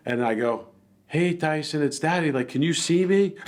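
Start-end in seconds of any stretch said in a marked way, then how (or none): 1.1-3.4 higher coaxing voice to the dog; gentle pet talk. Retold or imitated. Owner calming his dog, higher coaxing pet voice. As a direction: coax the dog, a bit higher and sing-song. Talking softly to attacking dog.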